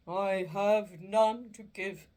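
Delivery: slow, loud, mournful spirit voice; a chained ghost lamenting its fate; doleful ghost. Acted. Marley's ghost lamenting to Scrooge, slow and doleful.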